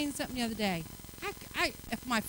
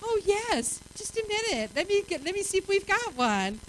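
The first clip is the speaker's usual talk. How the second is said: higher, sugary helpful voice; mock polite to a stranger; sweet politeness; 6 semitones higher than usual. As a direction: play nice to a stranger. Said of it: "You would be like".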